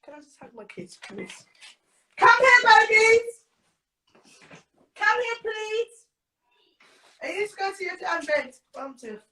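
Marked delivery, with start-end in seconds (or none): 2.2-6.0 louder, higher call across the room. Real, not acted. Mother calling her children over on the live stream, loud call.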